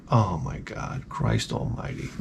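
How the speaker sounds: low, hushed mock despair; weary dismay. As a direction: mutter it low, as if to yourself. Dreading the child's request, muttered.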